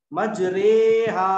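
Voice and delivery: मजरेहा with thin r and long 'ay'; thin raa, imala. Shown as a demonstration. Teacher illustrating thin raa in imala, मजरेहा with long 'ray'. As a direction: hold a loud, light 'ray' in मजरेहा. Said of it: "बारीक पढ़ी जाएगी".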